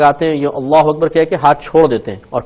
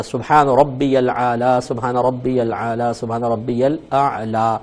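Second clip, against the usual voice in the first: drawn-out tasbih, fading softer; slow devout chant of ربی الاعلی; slow recitation; 3 semitones lower, 5 dB quieter, slower than usual. Shown as a demonstration. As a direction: recite it slowly three times, trailing off. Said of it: "یہ کو لام سے ملانا".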